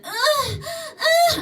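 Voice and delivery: high, loud mock moans; imitated loud moans. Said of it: "she's louder and louder".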